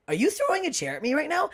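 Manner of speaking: high disbelieving question; incredulous. Retold or imitated. Comic to a heckler lifting a chair, high incredulous disbelief.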